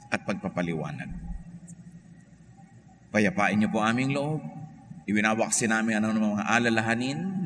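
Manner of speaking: gentle prayer to the Lord. Acted. Leads a prayer.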